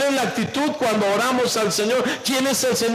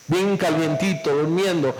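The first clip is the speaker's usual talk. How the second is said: ordinary talk, nothing marked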